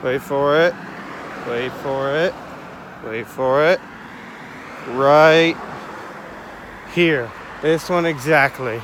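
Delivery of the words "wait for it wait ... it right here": repeated rising teases, then a loud reveal; suspenseful build-up. Real, not acted. Vlogger teasing viewers before the house comes in view, building suspense. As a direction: draw out the wait, punch "right".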